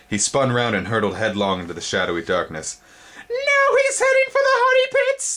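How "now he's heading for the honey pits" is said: very high, loud insect screech. A narrator's reading. Spindlebug seeing Twig flee, high loud screech. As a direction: screech the warning. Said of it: "the spindlebug screeched".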